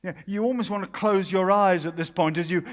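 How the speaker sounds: usual speaking voice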